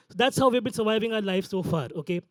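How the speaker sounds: ordinary talk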